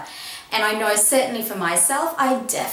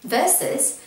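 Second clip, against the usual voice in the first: ordinary talk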